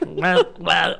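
lightly voiced creeping lizard critter; a playful critter voice, barely changed; lizard impression. Mock lizard voice for his co-hosts. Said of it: "an impersonation of some sort of critter".

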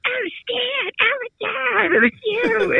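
very high squealed realization; squeaky mock outcry of discovery; imitated shocked manager. Manager realizes who planted the note, squealing mock shock.

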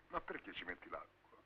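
muttered under the breath; quiet. Acted. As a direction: grumble it low and soft. Same question asked twice.

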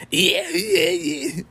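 very high imitation pig squeal; dying-pig squeal. Fan imitating Muscle Man's stupid squeal, very high piggy shriek. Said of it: "He squeals like a dying pig".

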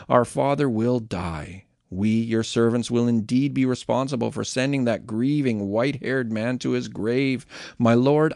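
steady, earnest Bible reading voice; scripture reading. A narrator's reading. Podcast host reading Genesis to listeners, earnest scripture reading. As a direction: read the passage like a daily devotional.

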